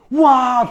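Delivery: loud, high "waah" burst; sudden yell. Retold or imitated. Teller mimics someone bursting in, sudden loud yell.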